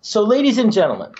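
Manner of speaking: announcer voice. Acted. Announcer addressing the audience.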